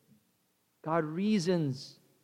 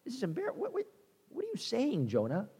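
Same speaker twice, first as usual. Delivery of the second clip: high, soft disbelieving squeak; incredulous reader; 7 semitones higher, 7 dB quieter, faster than usual. Retold or imitated. Readers baffled by Jonah, squeaking in quiet disbelief. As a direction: react as if you can't believe what Jonah just said. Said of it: "we're reading the story going".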